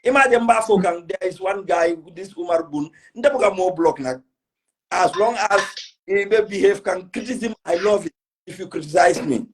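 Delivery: ordinary talk, nothing marked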